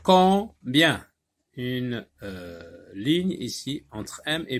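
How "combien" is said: loud, high Combien split into com and bien; syllable by syllable. Shown as a demonstration. Teacher showing the two syllables, loud and separated. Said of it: "ça donne deux syllabes".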